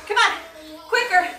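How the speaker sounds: barked numbers, rising high toward the end. Acted. Coach counting the class down to rest. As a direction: push them to the finish.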